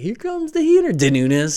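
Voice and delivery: high mocking call; mock pitcher taunt. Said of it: "he's like".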